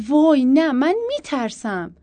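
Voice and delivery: high loud cry, slowing on the end; frightened shout. Acted. Wife crying out to Abbas that she's scared, a loud high shout. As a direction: yell it out in panic. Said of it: "داد زدم".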